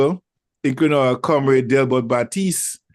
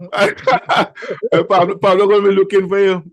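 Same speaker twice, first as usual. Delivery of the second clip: words bubbling through laughter, higher and louder; laughing; 7 semitones higher, 5 dB louder than usual. Real, not acted. Host teasing guest about Pablo, laughing. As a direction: tease him while cracking up.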